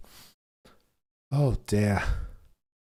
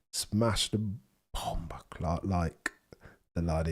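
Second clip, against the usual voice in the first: whisper; 3 semitones lower, 6 dB quieter than usual. Retold or imitated. Muttered slang aside.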